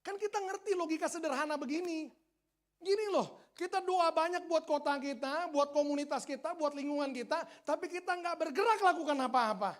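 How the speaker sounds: high, loud, exhorting sermon voice; forceful preaching. Real, not acted. Preacher chiding a church that prays but never acts, forceful preaching. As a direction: press the point hard on the congregation.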